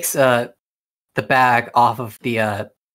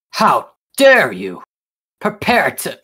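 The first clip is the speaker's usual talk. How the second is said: angry challenge; 2 semitones higher, 6 dB louder than usual. Acted. Wounded knight challenges the attacker, loud and indignant. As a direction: hurl it at him in outrage. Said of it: "looks at you and says".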